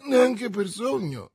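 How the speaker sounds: high, slow, loud denial; emphatic denial. A narrator's reading. Prisoner contradicting the commissioner, emphatic denial. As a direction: stretch out an emphatic no. Prisoner flatly denies identity.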